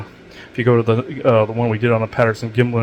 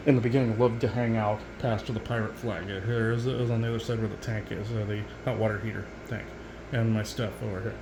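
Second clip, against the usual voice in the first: much softer, almost under the breath; quiet; 12 dB quieter than usual. Real, not acted. Walking the viewers round the haunted room, hushed. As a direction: drop way down as if not to disturb anything.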